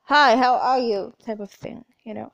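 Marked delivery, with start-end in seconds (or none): 0.0-1.1 loud slow shouted greeting; shouted greeting, loud person. Pretend. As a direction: belt the hello across the room. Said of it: "I would rather be".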